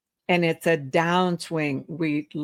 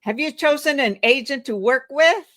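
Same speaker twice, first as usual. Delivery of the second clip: higher and louder, rising on "with"; upswing, uncertain; 7 semitones higher, 4 dB louder than usual. Shown as a demonstration. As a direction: let "with" lift like a doubt. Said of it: "going upswing is uncertain".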